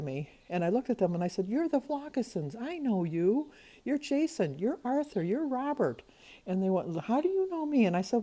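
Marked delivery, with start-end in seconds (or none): 1.5-6.0 high, eager fan gush; recognizing the boys in a dream, starstruck and high-pitched. Retold or imitated. Greeting dream boys excitedly. 7.0-7.8 high, surprised question; puzzled boy. Retold or imitated. Boys ask puzzled in dream.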